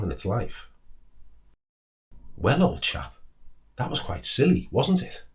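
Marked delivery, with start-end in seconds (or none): 2.4-5.3 higher, lightly voiced owl, rising to a teasing question; a touch of a stern old owl gently chiding; measured wise owl. Owl chiding the squirrel for losing its nut, measured. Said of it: "in its measured, deliberate voice".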